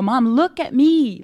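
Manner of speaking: slow, gentle call for her attention; tender plea to mom. Retold or imitated. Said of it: "and I go".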